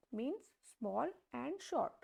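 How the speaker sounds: usual speaking voice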